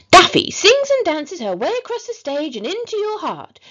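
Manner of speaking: high, loud gushing promo read; over-the-top children's show announcer; excited show advert. A narrator's reading. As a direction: sell the show with sing-song glee. Reads show flyer aloud as advert.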